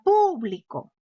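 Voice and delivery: público with the weight on PÚ; teacher shows público as an esdrújula word; stress on first syllable. Shown as a demonstration. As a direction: hit the opening pú of público hard. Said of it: "si yo quiero convertirla a esdrújula".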